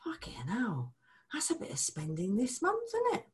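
hushed mock-shocked whisper; whispered dismay at the statement. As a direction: breathe it out at the bill. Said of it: "look at the credit card maybe and go".

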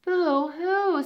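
high pretend boo-hoo; a hammed-up squirrel sob; mock crying. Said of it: "started to cry too".

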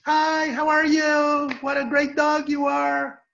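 very high, gushing praise for a dog; sing-song high voice for greeting a pet; high-pitched dog talk. Shown as a demonstration. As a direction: coo loudly to the dog, high and drawn out. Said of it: "in a high-pitched voice".

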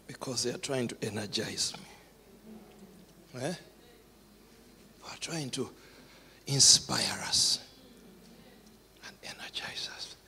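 tender hushed voice. Real, not acted. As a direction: let it fall to a whisper. Emotional near-whisper.

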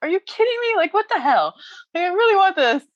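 high, exasperated disbelief; mock exasperation. Recalling the fall, high mock exasperation. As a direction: throw it up high in mock outrage. Retold frustrated outburst.

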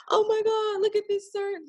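high, breathy gasp of excited awe; mock gasping intern. Mocking the interns who gasp at the surgeon. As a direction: gawk at the surgeon like a starstruck intern.

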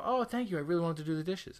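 high, gushing thank you; mock grateful thanks. Thanking the person who did the dishes, in a high, gushing voice. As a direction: pile on the gratitude without sarcasm. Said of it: "didn't think I was being condescending".

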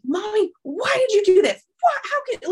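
put-on a five-year-old's high, loud, outraged protest; furious child. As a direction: mimic a furious little boy yelling at mom. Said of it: "like, he was furious".

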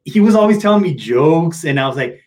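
usual speaking voice

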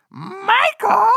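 very high, loud drawn-out call of a name; spooky call. Retold or imitated. Brother hiding under the bed calls out, spooky and loud. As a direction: call out eerily from the dark to scare him.

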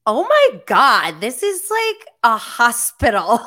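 loud awed exclamation, pitch up; oh my God, a hospital, loudly amazed; loud amazed gasp. Retold or imitated. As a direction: blurt it out, stunned by the fancy room. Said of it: "I was like".

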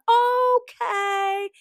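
high singsong agreement; sing-song okay. Retold or imitated. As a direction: answer like a cheerful morning person. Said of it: "Every word that they say starts to sing".

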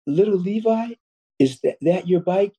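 lightly voiced bakery woman, pitched well up; a hint of a kindly older woman calling to a child; high sweet shopkeeper. Bakery woman asks little Levi about his bike, lightly voiced and high. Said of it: "the woman said".

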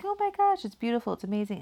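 high, delighted gush; excited. Retold or imitated. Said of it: "He's like".

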